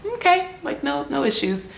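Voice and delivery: high-pitched little acted reply; light, high imitation of going along; mock easygoing acceptance. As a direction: pitch it up, pretending it is no problem. Acts out her own polite acquiescence.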